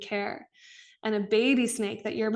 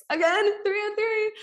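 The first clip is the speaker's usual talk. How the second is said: thrilled at the sign; 11 semitones higher, 4 dB louder than usual. Real, not acted. Spotting her apartment number again.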